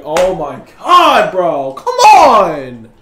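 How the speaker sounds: loud, drawn-out exasperated cry; exasperated outburst. Real, not acted. Gamer groaning over a bad play, loud exasperation. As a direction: wail it at the game in frustration.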